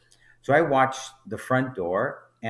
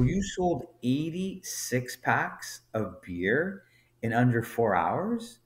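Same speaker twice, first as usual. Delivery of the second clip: slow, high-pitched disbelief; incredulous; 4 semitones higher, slower than usual. Retold or imitated. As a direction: spell it out slowly as a stunned question. Manager disbelieves the sales figure.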